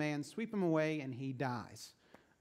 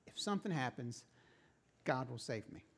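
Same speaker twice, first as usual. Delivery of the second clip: smug calm; 4 semitones lower, 4 dB quieter than usual. Acted. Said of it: "just smiles again through the window and says".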